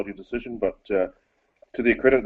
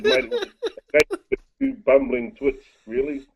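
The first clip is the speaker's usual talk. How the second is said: retold retort; 5 semitones higher, 3 dB louder than usual. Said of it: "I said".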